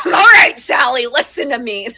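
harsh scolding tone. Shown as a demonstration. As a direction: bark it at the kid, then crack up. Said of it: "That tone just came across, not how I intended".

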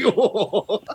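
laughing whoa. Real, not acted. Laughing at the prank reveal.

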